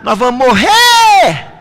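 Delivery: retold disciples shouting in a storm; panicked shout. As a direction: yell it like the boat is sinking. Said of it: "os discípulos começaram a gritar".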